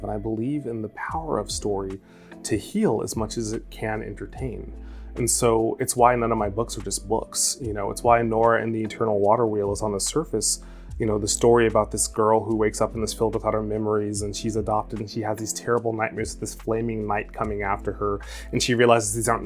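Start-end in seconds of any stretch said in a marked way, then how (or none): none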